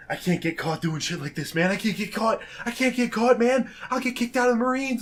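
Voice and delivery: mock panic, climbing high and urgent; panicked. Dan pleading with friends not to get caught, panicked. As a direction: play a frantic guy, pitch rising as the plea repeats. Retelling Dan's drunken panic.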